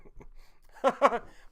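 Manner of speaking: a sudden high burst of laughter; loud laugh. Real, not acted. Laughing hard after being called the wrong name.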